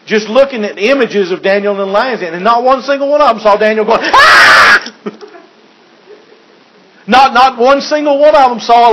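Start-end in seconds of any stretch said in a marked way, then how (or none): 4.0-4.9 a short hammed-up yelp of fright; playful fake scream of panic; mock panic yell. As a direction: cry out like a frightened man in the den. Mock Daniel freaking out.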